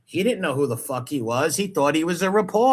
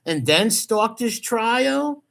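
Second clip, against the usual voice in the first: higher-pitched disbelieving questioning; incredulous; 5 semitones higher than usual. Real, not acted. Host picking apart a streamer's prison-letters story, incredulous. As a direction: repeat it back like you can't believe it.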